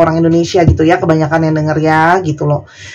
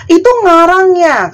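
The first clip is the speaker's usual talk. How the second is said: high-pitched mock complaint; mocking netizen; 10 semitones higher, 4 dB louder than usual. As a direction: mimic a snide commenter, pitched way up. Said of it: "Katanya gini". (meniru netizen yang nyinyir dengan suara tinggi)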